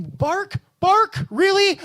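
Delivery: high, loud disbelieving repeat; incredulous. Retold or imitated. Comic retelling his disbelief at the waiter. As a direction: echo the word in mock shock. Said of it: "and I'm".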